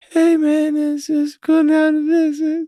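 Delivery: put-on very high, slow, breathy child's voice; a huge man talking like a small child, slow and high; imitating the massive guy's voice. Mocking how the Blackbird guy talks, very high and slow. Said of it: "he talks like".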